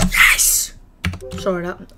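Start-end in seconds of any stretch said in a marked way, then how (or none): 0.1-0.7 throat-straining yell. Real, not acted. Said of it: "that really hurt my throat".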